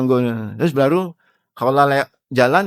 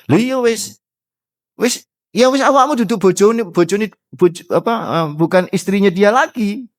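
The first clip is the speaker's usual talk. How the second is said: lightly voiced Prophet's verdict, higher and casual; 8 semitones higher than usual. Preacher voices the verdict to the wife, higher and offhand. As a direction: hand down the ruling offhand in Javanese.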